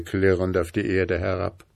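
ordinary delivery

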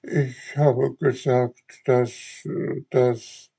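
put-on slow, halting child's stammer, caught out. A narrator's reading. Puppet boy stammers an excuse to the fairy, halting. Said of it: "stotterte Bengele".

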